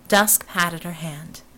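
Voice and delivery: ordinary delivery, nothing marked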